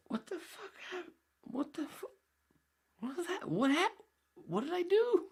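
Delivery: very high, squeaky stunned voice; dazed squeaky voice. Retold or imitated. Knocked flat by the girl, a dazed squeaky voice. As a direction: play a stunned cartoon victim. Dazed on the ground after punch.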